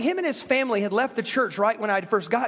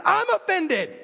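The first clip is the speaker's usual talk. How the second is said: higher, mock indignant protest; mock offended; 6 semitones higher than usual. Pastor telling the congregation how the apology offended him, mock indignant. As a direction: act out being offended by the apology, pitch raised. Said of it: "And I was so pumped up".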